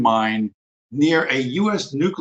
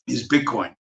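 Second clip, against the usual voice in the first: ordinary talk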